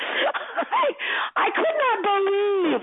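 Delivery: high, drawn-out mock disbelief; shocked. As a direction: exclaim it high, as if still shocked. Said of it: "that shocked me".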